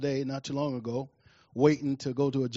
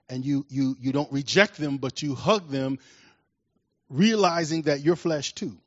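ordinary delivery

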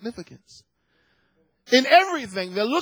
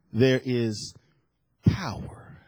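low, trailing into a whisper; hushed awe; 9 semitones lower, 4 dB quieter, slower than usual. Real, not acted. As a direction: let POWER fall to a whispered breath. Dramatic hush on key scripture phrase.

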